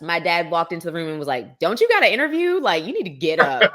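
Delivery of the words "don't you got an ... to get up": high, louder mock scolding dad; hint of a scolding father; imitating dad. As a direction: nag like a parent waking a kid.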